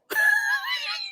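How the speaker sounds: squealing burst of laughter, very high; real high laughing squeal; high shrieking laugh. Real, not acted. Laughs at Bernie Mac reveal.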